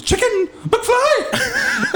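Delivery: very high, drawn-out bully taunt; Griff Tannen. Acted. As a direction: screech it slowly like a movie bully. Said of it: "And he goes".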